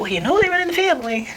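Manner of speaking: high-pitched, knowing mom voice; retold motherly reminder; imitating mom. Mom's retold reaction to twins.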